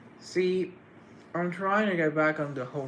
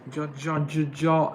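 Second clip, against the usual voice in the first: choppy broken-off syllables, a little louder; staccato; 2 semitones higher, 7 dB louder than usual. Real, not acted. Streamer greeting John in chat, accidental staccato stammer. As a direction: stutter the name in short clipped bursts. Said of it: "I don't know why the staccato happened".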